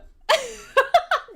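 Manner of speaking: loud high-pitched laughter; laughing. Real, not acted. Host tickled by the silly welcome, loud laughing. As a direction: crack up right after the joke.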